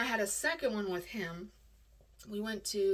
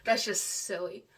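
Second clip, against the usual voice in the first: usual speaking voice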